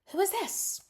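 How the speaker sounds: very high, affronted demand; indignant. Retold or imitated. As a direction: act out an outraged confrontation. Voicing ex-girlfriend confronting new woman.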